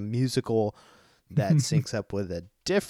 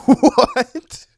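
very high, loud incredulous yelp; real astonished squawk; baffled disbelief; 13 semitones higher, 11 dB louder than usual. Real, not acted. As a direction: blurt it out, totally baffled. Baffled by the odd review title.

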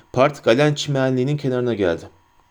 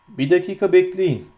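ordinary delivery, nothing marked

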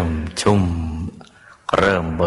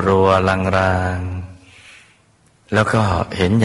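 drawn out slowly; much slower than usual. Acted. Describing faint vision, stretched.

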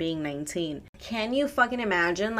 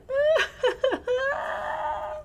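high burst of laughter. Real, not acted. Laughs about the shower.

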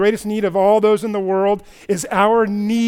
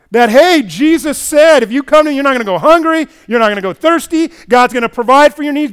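lightly mocked preacher, louder and higher; prosperity preacher pitch; 6 semitones higher, 9 dB louder than usual. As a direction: push the promise like a prosperity preacher. Voicing health wealth gospel promise.

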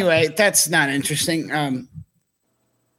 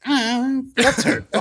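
much higher, hammed-up wounded tone; mock hurt; 9 semitones higher than usual. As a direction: play the offended friend for laughs. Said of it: "And I was like".

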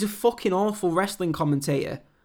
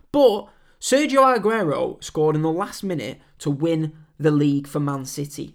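usual speaking voice

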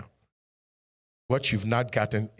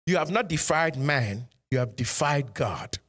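ordinary delivery

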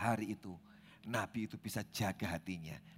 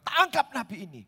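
loud, higher barked command; angry king's order; 14 semitones higher, 12 dB louder than usual. Acted. Enraged king ordering guards to seize the prophet, loud bark. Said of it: "Yerobeam sangat marah".

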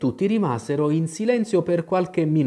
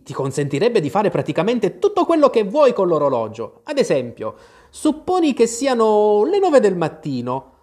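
high, loud, animated character voice; eccentric Hatter, excited; 8 semitones higher, 5 dB louder than usual. A narrator's reading. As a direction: explain with mounting excitement, pitch climbing. Hatter lectures Alice about Time. (cappellaio eccentrico e infervorato)

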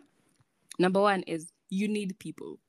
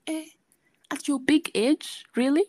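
teasing disbelief; 8 semitones higher, 4 dB louder than usual. Retold or imitated. Her own retold teasing reply.

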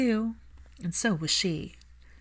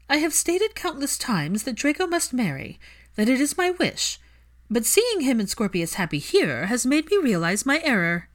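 high, louder formal declaration; Narcissa's voice; 7 semitones higher, 5 dB louder than usual. A narrator's reading. Narcissa admits her error to the family, high and formal. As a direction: proclaim it with aristocratic gravity.

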